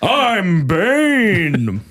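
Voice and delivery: high, swooping theatrical villain voice; grand, drawn-out masked-villain admiration; Bane. Acted. Host repeats the villain impression over laughter, Bane voice. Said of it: "Bane impression, of the week".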